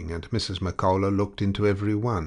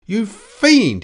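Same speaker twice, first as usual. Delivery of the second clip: very high, very loud yell; furious shriek at the agent; 12 dB louder than usual. A narrator's reading. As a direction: scream the insult. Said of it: "he yelled out".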